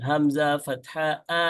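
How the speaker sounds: "Hamza ফাতহা আ" slowly chanted, last vowel drawn out; rhythmic recitation tune. Shown as a demonstration. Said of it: "সুর দিয়ে এভাবে".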